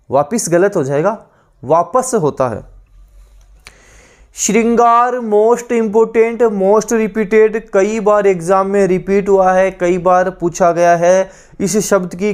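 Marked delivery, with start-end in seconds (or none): 4.3-7.6 slow, high, drawn-out श्रृंगार; emphatic teacher stress. Shown as a demonstration. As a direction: stretch it out so students remember. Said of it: "teacher stresses key word". (ज़ोर देकर, धीरे और खींचकर)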